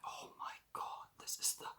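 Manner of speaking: hushed, unvoiced whisper of awe; awestruck whisper. Idolising their favorite person, whispering in awe. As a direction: whisper it as if overwhelmed.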